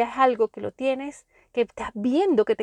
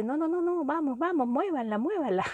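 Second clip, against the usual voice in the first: higher, softer mock urging; lightly voiced life; 4 semitones higher, 4 dB quieter than usual. Life nudging someone out of inertia, lightly acted urging. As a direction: lightly play life hurrying someone along. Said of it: "pareciera ser que la vida dice".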